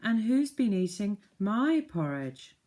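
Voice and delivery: a motherly bear, drawn out. Acted. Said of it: "said the mother bear".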